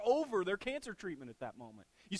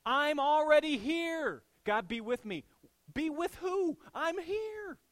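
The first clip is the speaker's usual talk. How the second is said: high, loud mock-indignant God; a hammed-up God, louder and higher; God answering; 10 semitones higher, 7 dB louder, slower than usual. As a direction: play God pointing out he's already there. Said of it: "I picture God looking at us going".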